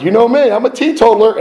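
high, loud fake innocence; mock innocent claim. Pilot imitating himself fooling the crew, mock innocent. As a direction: play the sly liar sweetly.

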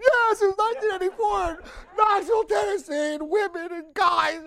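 high, loud shouted outburst; blurted confession. Retold or imitated. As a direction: finally blurt it all out at the top of your voice. Blurting out his secret.

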